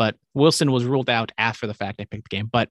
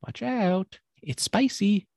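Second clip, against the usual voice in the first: higher-pitched, cheesy ad tagline; mock commercial pitch; 8 semitones higher, much slower than usual. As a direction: lightly mimic a cringy sandwich commercial. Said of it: "Subway commercials he's been doing".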